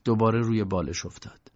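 plain reading voice

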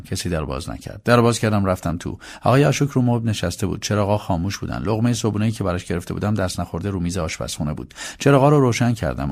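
plain reading voice

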